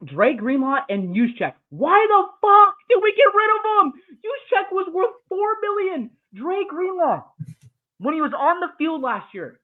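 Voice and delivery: ranting, shouting high and loud about the trade; furious rant. Real, not acted. Football podcaster losing it over his team's moves, furious shouting. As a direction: explode in outrage, voice cracking high. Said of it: "That's the end of my crash out".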